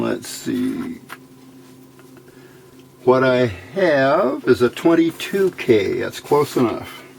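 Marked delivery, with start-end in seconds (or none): none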